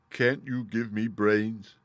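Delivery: slow, pleading straw-man voice; Scarecrow asking. Acted. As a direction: drag it out like a simple scarecrow. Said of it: "asked the Scarecrow".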